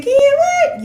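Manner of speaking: high, loud incredulous "Ken, what?"; mock viewer surprise. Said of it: "You said".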